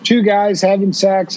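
ordinary talk, nothing marked

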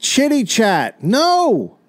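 slow, high, drawn-out mock yell; exasperated shouting. Misheard official repeating himself over the crowd, exasperated shouting. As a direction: stretch each word to carry over noise.

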